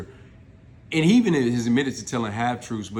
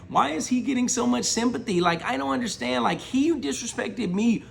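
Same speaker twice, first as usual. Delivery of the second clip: high, whiny imitation of a tearful complaint; mock crying whine; 10 semitones higher than usual. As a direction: imitate her tearful whining, pitched up. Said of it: "Anaya crying, saying".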